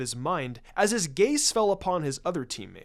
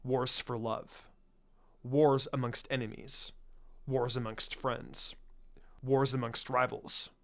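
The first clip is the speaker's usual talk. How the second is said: solemn legend reading; 4 semitones lower than usual. A narrator's reading. The narrator reads Hinata's scroll fable, lower and solemn. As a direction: intone it like an old myth from a scroll.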